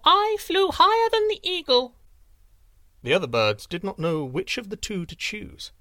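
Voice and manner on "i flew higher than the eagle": wren crowing. A narrator's reading. Wren taunting the eagle from above, high and triumphant. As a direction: crow it from the sky. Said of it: "said the wren".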